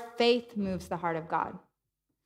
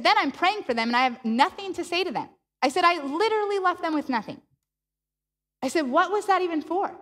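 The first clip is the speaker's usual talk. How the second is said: exasperated; 7 semitones higher than usual. Retold or imitated. Complaining to God, exasperated.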